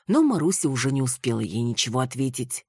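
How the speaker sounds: ordinary delivery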